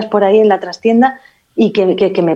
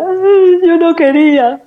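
high, louder mock-weepy plea; hammed-up tearful complaint; imitating a crying friend; 9 semitones higher, 4 dB louder, slower than usual. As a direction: whine it like she's in tears. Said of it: "ahí llorando la criatura".

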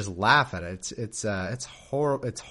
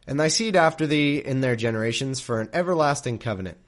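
louder, formal King James reading; scripture reading; 4 dB louder than usual. A narrator's reading. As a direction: read it like solemn scripture. Reads scripture aloud.

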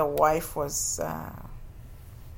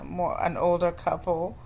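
ordinary talk, nothing marked

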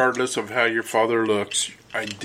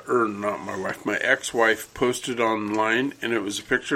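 ordinary delivery

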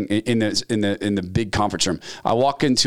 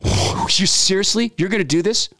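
indignant complaint; 8 semitones higher than usual. Retold or imitated. Employee to boss over taken parking spot, high indignant outburst. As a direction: demand it in disbelief.

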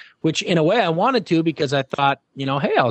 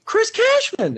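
even higher, more insistent call of his name; much slower than usual. Retold or imitated. Fan keeps calling the host's name across the airport, higher insistent call. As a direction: call again, sure it's him. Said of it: "and this girl goes".

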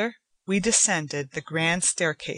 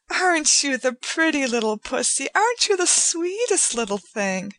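put-on much higher baby talk to a pet; cooing; 8 semitones higher than usual. A narrator's reading. Joanna coos baby talk to the cat on her lap, much higher. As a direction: coo over the kitty. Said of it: "cooing the silly little words".

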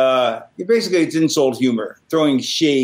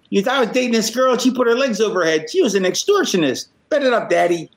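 higher, fast hype-man rant; mock hype-man story, voice pitched up; imitating Fonzie; 6 semitones higher than usual. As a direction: rattle it off like an excited wrestling manager. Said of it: "So he goes".

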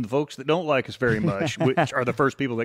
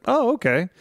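high, louder "oh, okay"; retold surprise; 12 semitones higher, 5 dB louder than usual. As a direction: act out being taken aback, pitched high. Retold reaction to an offer.

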